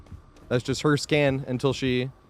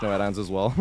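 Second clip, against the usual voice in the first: ordinary talk, nothing marked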